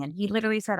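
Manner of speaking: ordinary talk, nothing marked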